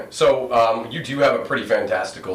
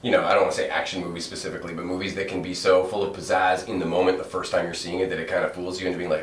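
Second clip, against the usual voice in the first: ordinary talk, nothing marked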